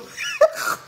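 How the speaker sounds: sudden squeal of laughter; short high-pitched laughing burst. Real, not acted. Breaks into laughter mid-complaint.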